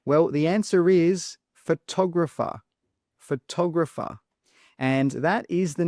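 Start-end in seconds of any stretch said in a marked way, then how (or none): 1.5-4.2 "photographer" stressed on the second syllable, TOG; fuh-TOG-ruh-fer, punch the TOG in "photographer"; correct word stress. Shown as a demonstration. Teacher gives the answer on how to pronounce it, stressed TOG. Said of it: "use correct stress".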